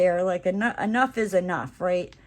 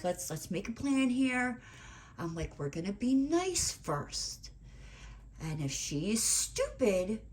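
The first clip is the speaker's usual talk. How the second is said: inner voice; 7 dB quieter than usual. Retold or imitated. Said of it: "quickly in my head".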